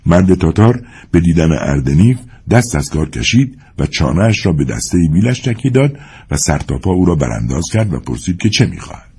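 ordinary delivery, nothing marked

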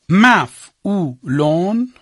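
very slow drawn-out dictation; dictation pace. Shown as a demonstration. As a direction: stretch each syllable for writing. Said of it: "شاخه باز کن".

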